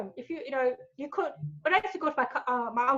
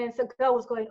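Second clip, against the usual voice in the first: ordinary talk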